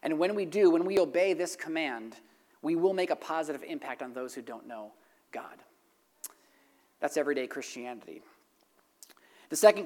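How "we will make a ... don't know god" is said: ordinary talk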